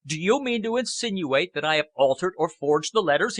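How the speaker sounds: indignant shout at a council member. Acted. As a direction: shout the accusation in outrage. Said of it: "he shouted".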